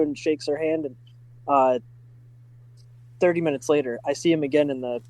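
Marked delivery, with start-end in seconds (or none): none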